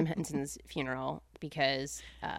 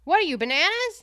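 silly catchphrase voice; 12 semitones higher, 5 dB louder than usual. Acted. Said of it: "Do the bananas one".

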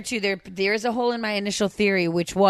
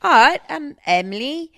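higher, louder Australian attempt, slowed; a mock Australian accent, raised and loud; 6 semitones higher, 6 dB louder, slower than usual. Shown as a demonstration. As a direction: try Australian, pitch up and push it. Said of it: "it gets a little Cockney".

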